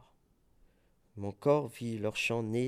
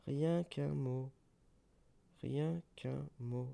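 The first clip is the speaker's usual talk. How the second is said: fading echo; 6 dB quieter, slower than usual. Acted.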